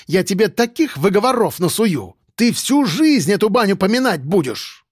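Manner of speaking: high-pitched woman boss's tirade; furious scolding. Acted. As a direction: hurl insults and threats, worked up. Boss threatens and insults employee.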